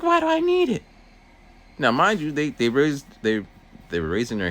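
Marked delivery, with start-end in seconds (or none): 0.0-0.8 much higher, louder defiant whine; whiny student voice. Retold or imitated. Student whining back at the aide, loud and high. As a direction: push back like a sulky kid.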